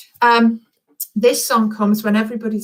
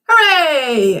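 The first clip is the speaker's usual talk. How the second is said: high, loud cheer of hooray; shout hooray; 6 semitones higher, 4 dB louder than usual. Shown as a demonstration. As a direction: arms up and cheer it out. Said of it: "throw your arms up in the air and go".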